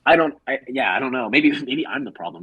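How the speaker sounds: ordinary talk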